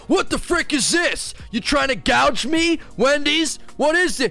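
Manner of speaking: high-pitched, hammed-up indignation; outraged customer. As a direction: play a customer absurdly furious over free fries. Acts out outraged Wendy's customer.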